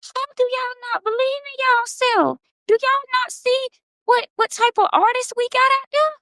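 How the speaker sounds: high, incredulous pleading outcry; exasperated disbelief. Retold or imitated. A writer begging her followers to believe in themselves, high and incredulous. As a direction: plead with them, baffled they can't see it. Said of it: "And I'm like".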